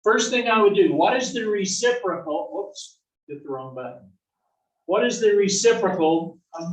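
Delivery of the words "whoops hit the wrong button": usual speaking voice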